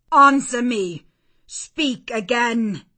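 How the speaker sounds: loud, high command, slowing at the end; imperious order. A narrator's reading. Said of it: "imperiously and aloud".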